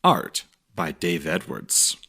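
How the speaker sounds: plain reading voice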